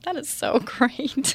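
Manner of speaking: slow, high, delighted gush with a laugh; imitating her own thrilled reaction, drawn out and laughing; a giddy retold "so great"; gushing excitement. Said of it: "And I was like".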